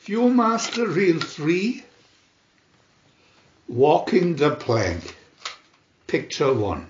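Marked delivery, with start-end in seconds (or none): none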